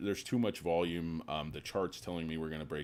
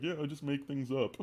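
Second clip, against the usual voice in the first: old bear impression; 10 semitones higher than usual. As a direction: play a fearful boomer investor. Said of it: "It's like".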